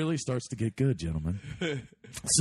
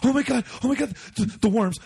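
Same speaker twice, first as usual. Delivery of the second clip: high, louder mock panic; panicked girl; 10 semitones higher, 4 dB louder than usual. Girl tripping on acid warns of worms, mock panicked. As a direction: imitate her stammering freak-out, high-pitched.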